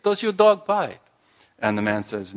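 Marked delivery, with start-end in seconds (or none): 0.0-1.0 put-on French accent, higher and louder, insisting. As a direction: repeat it in a French accent to wake him. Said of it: "so he repeats it".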